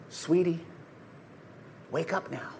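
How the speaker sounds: very soft, tender near-hush; gentle waking. Acted. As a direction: wake a sleeping child softly. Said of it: "what you would do if you were waking up a child".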